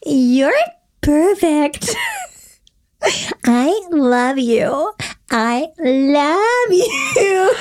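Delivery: put-on cutesy high baby talk. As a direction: coo it like talking to a baby. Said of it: "he goes in a baby voice".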